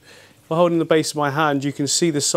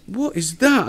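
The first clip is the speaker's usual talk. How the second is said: higher-pitched puzzled exclamation; retold surprise; 7 semitones higher, slower than usual. Owner retelling his first sighting in the tank, surprised. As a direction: act out spotting something strange.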